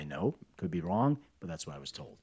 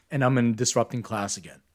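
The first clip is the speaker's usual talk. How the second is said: normal talking voice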